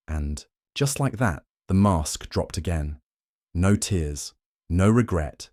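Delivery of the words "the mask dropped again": plain reading voice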